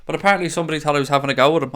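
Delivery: usual speaking voice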